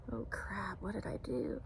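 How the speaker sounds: half-whispered, worried aside to oneself; hushed dismay. Retold or imitated. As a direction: say it under your breath, as a retold thought. Her dismayed thought, retold.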